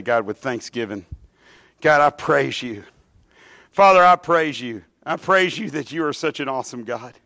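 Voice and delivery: earnest spoken prayer, swelling on each Lord and God. Acted. Pastor leading the church in prayer, earnest and rising. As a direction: pray it aloud over the people.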